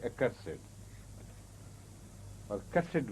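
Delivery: "accursed" and "cursed" with -ed as its own syllable; adjective pronunciation. Shown as a demonstration. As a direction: say uh-KUR-sid for "accursed", KUR-sid for "cursed". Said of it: "pronounced as accursed". ("accursed" and "cursed" both ending in -sid)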